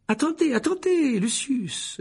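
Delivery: a frightened minister scrambling; panicked Fudge. A narrator's reading. Fudge panics as Malfoy suspends Dumbledore. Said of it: "l'air affolé".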